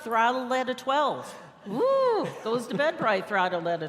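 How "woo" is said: loud, high party whoop; hammed-up extrovert "woo"; excited whoop. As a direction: whoop at full throttle. Acting out full-throttle extrovert waking.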